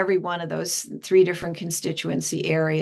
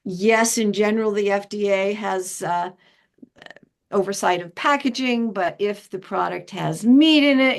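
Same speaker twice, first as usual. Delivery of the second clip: ordinary delivery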